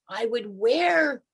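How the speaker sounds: normal talking voice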